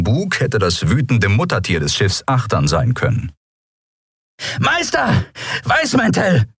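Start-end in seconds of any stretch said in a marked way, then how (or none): 4.6-6.5 high-pitched warning call. A narrator's reading. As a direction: call the alarm up from below. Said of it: "hörte er Lahn von unten rufen". (hoher, warnender Ruf)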